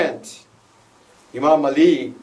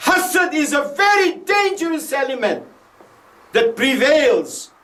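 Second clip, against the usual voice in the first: preaching shout; 11 semitones higher than usual. Real, not acted. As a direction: thunder the warning at the congregation. Preacher warns congregation about envy.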